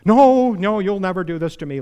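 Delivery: loud, high burst of refusal; Peter's loud startled no; Peter protesting. Retold or imitated. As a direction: blurt it out like impulsive Peter. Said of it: "Peter, the man with the foot-shaped mouth".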